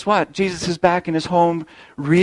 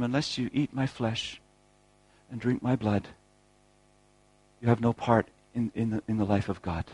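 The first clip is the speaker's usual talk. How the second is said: softer, hushed words of Jesus; hushed solemn; 3 semitones lower, 7 dB quieter than usual. Acted. Preacher quoting Jesus to the crowd, hushed and solemn. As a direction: lower your voice to let the mystery land.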